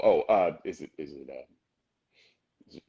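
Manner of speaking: usual speaking voice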